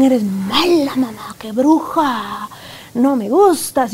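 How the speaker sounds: put-on slow, breathy child voice hissing insults; sulky child. A child telling his mother she is a witch, slow sulky whisper. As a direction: spit it out slowly like a resentful kid.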